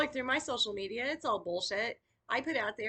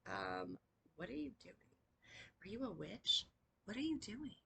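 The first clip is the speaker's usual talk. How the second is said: soft, wary mock questioning of a weirdo; puzzled skeptic; 7 dB quieter than usual. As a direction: sound like a doubtful outsider poking at her. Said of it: "sit there and be like".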